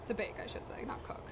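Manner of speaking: hushed, unvoiced aside; whispered self-correction. Real, not acted. Baker corrects her own word to viewers, whispered aside. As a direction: whisper the fix to yourself.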